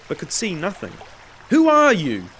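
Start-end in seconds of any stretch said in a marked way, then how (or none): 1.5-2.3 high, loud, shrill challenge; shrill call. A narrator's reading. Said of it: "he called shrilly".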